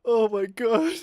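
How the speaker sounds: high voice left over from real laughter; laughed out. Real, not acted. Storyteller recovering from his own impression, high and laughed-out. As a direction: sigh it out after cracking up.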